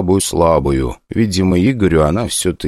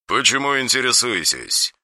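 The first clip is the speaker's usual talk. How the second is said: cold; 5 semitones higher, 6 dB quieter than usual. Acted. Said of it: "Не менее холодно осведомились".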